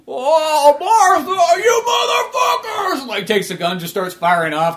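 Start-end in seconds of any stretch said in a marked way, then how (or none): 0.1-3.0 high, loud grief-stricken wail turning to rage; anguished scream. Retold or imitated. Old George over his wife Martha's body, anguished rising scream. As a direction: cry out over his dying wife, then curse them.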